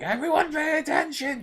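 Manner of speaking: high-pitched mock teacher calling out; imitated frantic teacher. Teacher trying to get through the lesson, mock high-pitched call. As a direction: squeal it like a teacher losing the class.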